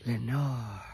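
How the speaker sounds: low, soft murmur of the name; murmured echo. A narrator's reading. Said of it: "an echo murmured back the word".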